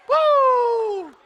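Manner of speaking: very high, loud "woo"; exultant whoop. Real, not acted. Preacher overcome while praising the Lord, exultant whoop. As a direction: let out a long, high whoop.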